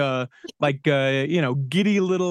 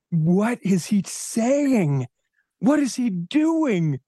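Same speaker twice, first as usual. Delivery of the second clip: stunned disbelief; 6 semitones higher, much slower than usual. Retold or imitated. Daughter's shocked inner thoughts.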